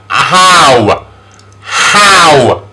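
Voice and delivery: long, gliding OW in How; vowel demonstration. Shown as a demonstration. Teacher shows O and W together, How drawn out. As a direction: stretch out each How separately. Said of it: "very easy to say together".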